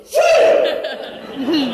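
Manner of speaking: high, sudden "phew"; imitated relieved whoop. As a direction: mimic a boy's burst of relief. Son's relieved exclamation retold.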